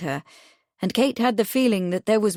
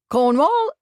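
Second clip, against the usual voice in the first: high, louder squawking parrot; chuckling parrot; 7 semitones higher, 6 dB louder than usual. A narrator's reading. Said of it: "chuckled the parrot".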